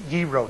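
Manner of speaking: gyro said yee-roh, no G sound; gyro softly as yee-roh; correct gyro. Shown as a demonstration. Teacher repeats the Greek gyro, yee-roh. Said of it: "They don't have a G sound".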